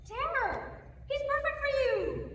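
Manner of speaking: high, loud, gushing; gushing excitement. Acted. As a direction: gush with delight. Nurse gushes over her friend's romance.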